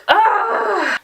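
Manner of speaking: long, high groan of frustration; exasperated groan. Real, not acted. Girl venting about her friend skipping class, exasperated groan. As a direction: let out a high, drawn-out groan.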